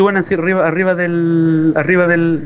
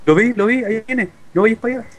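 urgent warning; 6 semitones higher than usual. Real, not acted. Monster coming, urging to run.

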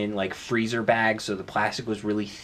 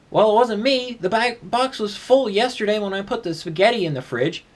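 defensive insistence; 10 semitones higher, 3 dB louder than usual. Acted. Acted quarrel with roommate.

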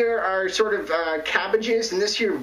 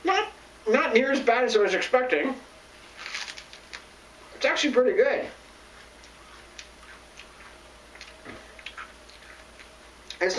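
talking while chewing leaves; words muffled by food; mouth full, chewing; 6 semitones higher than usual. Real, not acted. Seen 0: Holds kale at mouth, lips working as though chewing while talking.

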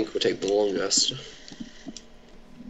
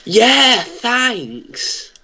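high, sarcastic yeah thanks; 12 semitones higher, 3 dB louder than usual. Real, not acted. Sarcastic thanks after dying.

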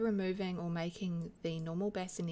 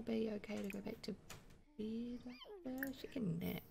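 hushed whisper; 4 semitones higher, 5 dB quieter than usual. Real, not acted. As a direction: whisper so she can nap. Baby going to nap.

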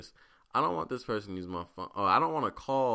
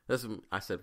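ordinary delivery

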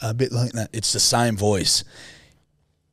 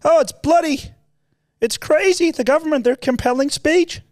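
high, loud mock Peterson rant; high-pitched outraged lecturing impression; Jordan Peterson impression; 3 dB louder than usual. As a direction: squeak out his outraged complaining. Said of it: "he talks like this".

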